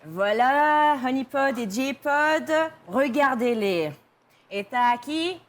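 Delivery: lively host signing off; show host sign-off. Pretend. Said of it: "sign out in the different languages".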